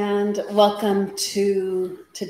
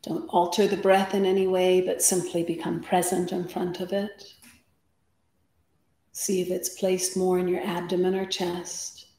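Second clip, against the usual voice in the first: guided meditation. Acted. Yoga teacher settling the class before practice, guided meditation. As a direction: guide them into stillness, unhurried, phrase by phrase.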